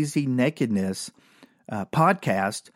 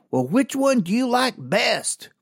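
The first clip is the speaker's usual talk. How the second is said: higher, slow put-on woman's question; lady's voice; 10 semitones higher than usual. Comic retelling of the lady stopping to ask, slow higher voice. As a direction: draw the question out like the curious lady. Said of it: "She goes".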